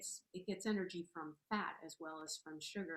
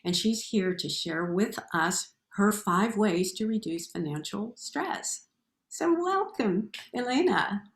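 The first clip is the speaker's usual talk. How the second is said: loud, upbeat show host intro; announcer voice; 14 dB louder than usual. Acted. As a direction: introduce the guest like a presenter.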